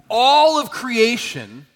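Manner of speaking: slow booming emphasis; emphatic proclamation. Acted. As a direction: proclaim it big, drawing out the words. Preacher expounding Jesus' words emphatically.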